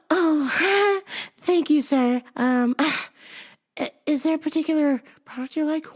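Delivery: high, flustered femme service voice; super femmy. Acted. Store worker thanking a flirting customer, super femmy.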